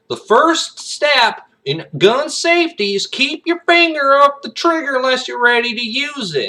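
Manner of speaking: high, loud Southern youngster voice; Southern kid. Acted. Kid lecturing on gun safety to camera, Southern accent. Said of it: "like, your Southern".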